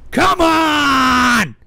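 loud, high drawn-out yell; fed-up yell at the trolls; exasperated shout. Real, not acted. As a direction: cry out in frustration at the chat.

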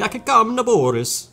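hammed-up, high Russian accent; loud, terrible mock Russian accent; fake Russian accent. Gamer reads Link's reply about Naboris, terrible fake Russian accent. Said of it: "With my fake Russian accent".

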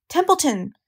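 high, urgent name call; mild scream. A narrator's reading. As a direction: call out high, without full volume. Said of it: "screamed Wilbur".

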